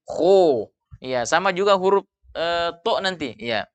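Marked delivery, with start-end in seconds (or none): 0.1-0.7 KHO rounded towards "khu"; wrong pouted kho. Shown as a demonstration. Teacher warns against pouting the letter, pouted kho. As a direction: say "Kho" with lips pushed forward. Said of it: "dibaca dengan memonyongkan bibir".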